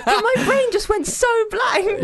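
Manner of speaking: high, slow wail through real laughter; laughing exasperation. Real, not acted. Guesser kicking herself for missing the answer, laughing in exasperation. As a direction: laugh helplessly at your own slip.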